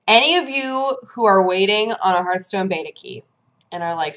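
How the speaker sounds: usual speaking voice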